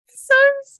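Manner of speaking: very high, loud sarcastic "Sucks"; sneering about a girl everyone likes, very high and sarcastic; sarcastic squeak. Retold or imitated. Sarcastic remark about disliked girl.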